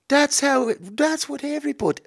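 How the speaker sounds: put-on high-pitched, failed Irish accent; bad Irish accent. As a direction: attempt an Irish accent and miss. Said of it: "I can't do the Irish accent".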